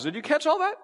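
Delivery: ordinary talk, nothing marked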